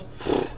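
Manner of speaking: voiceless puff of exhaustion. A narrator's reading. Ice-cream man finds it hard, puffing out. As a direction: blow out air, worn out.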